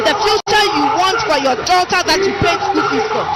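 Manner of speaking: shouted appeal. Real, not acted. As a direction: call it out to the crowd. Rallying crowd, shouting.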